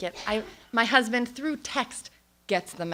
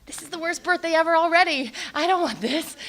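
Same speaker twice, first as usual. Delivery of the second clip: high, louder mock whine; hammed-up dismay, pitched up; exasperated complaint; 7 semitones higher, 6 dB louder than usual. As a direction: protest like a fed-up birthday girl. Acted dismay at sick birthday.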